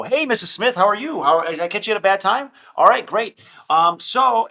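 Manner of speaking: high, loud, overeager salesman. Shown as a demonstration. Demoing the wrong way to call a seller, high, loud sales voice. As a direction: pitch it like a corporate cold caller. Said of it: "it's like a sales voice".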